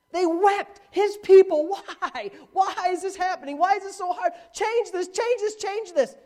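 high, strained whining complaint; mock wailing people. Preacher mimicking Israel's wailing to God, high whine. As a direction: whine it like a desperate crowd.

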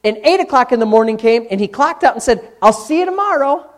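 loud, high, drawn-out preaching; full-force preaching. Real, not acted. Preacher driving home to congregation that change takes time, loud and emphatic. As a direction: preach it hard, stretching key words.

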